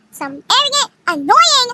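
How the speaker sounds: high, punched-up jab; pointed insult. Retold or imitated. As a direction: pitch the insults up for emphasis. Acted insult in the argument.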